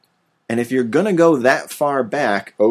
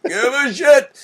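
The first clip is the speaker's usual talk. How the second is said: high, slow, drawn-out mock wail; mock exasperation; 14 semitones higher, slower than usual. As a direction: whine it out in exaggerated boredom. Mock outburst about dull plot.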